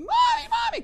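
put-on high, loud child's scream for mommy; shrill-pitched, full-volume child's call; little girl crying out. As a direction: shriek it like a panicked little kid. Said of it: "little girl says".

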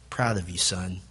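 tender father praise. Pretend. Father praising grown child.

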